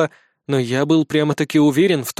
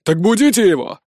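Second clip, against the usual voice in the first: indignant shout, higher; 3 semitones higher than usual. A narrator's reading. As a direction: yell up at the wall guards. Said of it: "крикнул Рокон".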